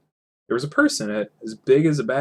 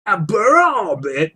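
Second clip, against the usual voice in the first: slow, high, loud puzzled trolls; startled trolls; 9 semitones higher, 6 dB louder, slower than usual. Acted. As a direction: trolls repeating it slowly, startled. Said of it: "a bit startled".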